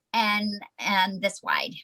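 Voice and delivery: ordinary talk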